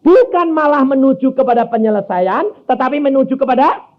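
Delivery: high, loud pulpit proclamation; full-force preaching. Acted. Preacher driving the point home to the church, loud preaching. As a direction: preach it out to the congregation.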